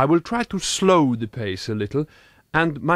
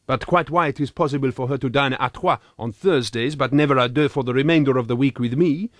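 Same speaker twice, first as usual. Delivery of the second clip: usual speaking voice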